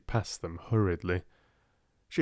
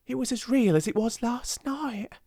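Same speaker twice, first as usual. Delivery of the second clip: higher, hushed delight; excited whisper; 14 semitones higher than usual. A narrator's reading. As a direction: still amazed it was real. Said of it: "she whispered excitedly".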